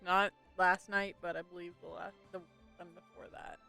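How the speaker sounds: ordinary talk, nothing marked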